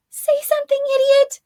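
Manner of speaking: put-on very high, pleading urgent girl; desperate urging. A narrator's reading. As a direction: hiss at her friend to speak up.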